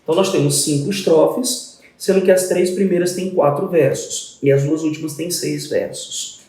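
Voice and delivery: ordinary talk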